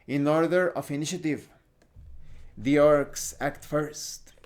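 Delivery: ordinary talk, nothing marked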